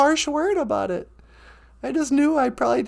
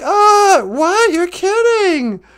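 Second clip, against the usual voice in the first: mock innocent surprise; 6 semitones higher, 7 dB louder, much slower than usual. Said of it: "And I was like".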